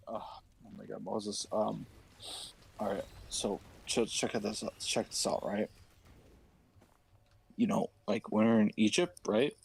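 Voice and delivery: low, nervous voice of an excuse-maker; nervous Aaron. Acted.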